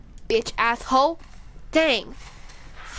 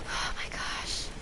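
frightened whisper; 11 dB quieter, slower than usual. Real, not acted.

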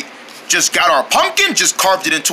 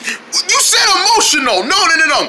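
ordinary talk

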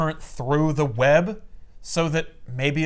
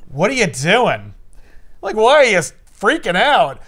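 loud confused; 4 semitones higher, 6 dB louder than usual. Retold or imitated. Juggernaut confused, retold.

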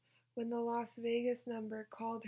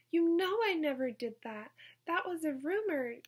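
whining high and loud; a high school whine of denial; whiny; 5 semitones higher, 7 dB louder than usual. Acted. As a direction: whine like a teenager caught out. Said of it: "with the same whine".

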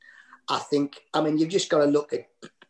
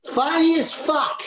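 high, slow and loud; high slow mock girl voice; young girl's verdict; 14 semitones higher, 5 dB louder, slower than usual. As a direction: ape a young girl's blunt praise. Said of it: "one of them went".

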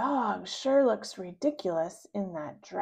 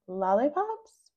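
plain reading voice

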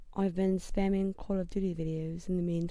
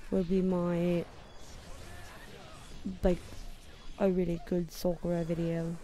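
put-on amateur London accent, done for fun; playful, imperfect London accent. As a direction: talk like a Londoner out of Harry Potter. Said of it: "a London accent".

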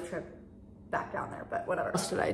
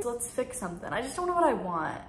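ordinary talk

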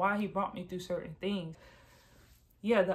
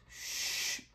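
drawn-out "shh" of something sliding away; soft hissed sound effect of a bubble dropping; imitated whoosh going down; 6 dB quieter than usual. Shown as a demonstration. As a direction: make a soft shushing whoosh. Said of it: "it went down like this".

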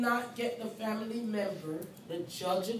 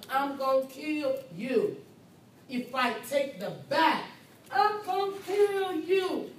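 acted threat; 6 semitones higher, 4 dB louder than usual. Acting a threat with a bat.

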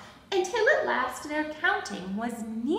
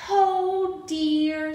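higher, louder storybook sigh; 6 semitones higher, 7 dB louder than usual. A narrator's reading. Abigail with nothing left to count, acted sigh. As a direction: sigh it out theatrically for children. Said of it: "sighed Abigail".

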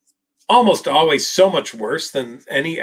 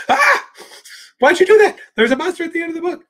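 much higher, fast, panicky puppet voice; panicked Grover; 5 dB louder, faster than usual. Retold or imitated. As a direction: squeal it like a frantic little monster. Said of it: "and he goes".

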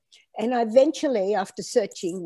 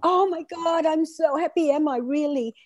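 higher-pitched excited gush; retold delight; 6 semitones higher than usual. Woman reliving her reaction to the records clerk, delighted and high. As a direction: relive the thrill of being told good news.

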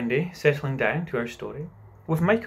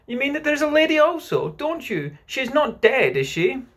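put-on high, urgent child voice; excitable little twin, loud and high; child's anxious cry; 12 semitones higher, 5 dB louder than usual. A narrator's reading. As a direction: plead for an answer, pitched up. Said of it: "cried the first twin".

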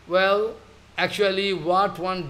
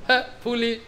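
scolding emphasis; 5 semitones higher than usual. Real, not acted. Preacher scolds devotee.

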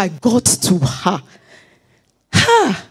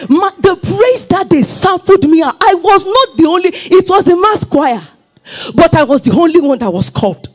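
preaching at full force; 5 semitones higher than usual. Acted. Preacher rousing the congregation to praise, at full force. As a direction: preach it out with rising fire.

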